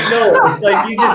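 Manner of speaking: high, loud, through laughter; laughing protest. Real, not acted. Explaining to an offended co-host, laughing hard. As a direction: protest while cracking up. Cracking up after S2's offended question.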